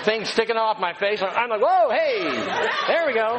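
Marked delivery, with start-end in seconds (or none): 1.6-3.4 high, laughing startled yelp; startled laughing whoa. Retold or imitated. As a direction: caught off guard, laugh it out. Startled as Susie touches face.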